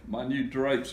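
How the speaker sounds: mild high-pitched cry of dismay; understated storybook wail. A narrator's reading. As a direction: cry out over a ruined treasure, restrained. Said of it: "cried Mother".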